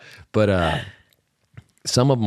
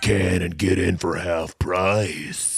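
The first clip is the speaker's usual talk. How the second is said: promo voice; slower than usual. Pretend. Goofy promo voice.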